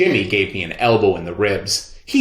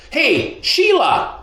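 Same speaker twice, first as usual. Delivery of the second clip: calling out; slower than usual. A narrator's reading. Boy calls out to Sheila.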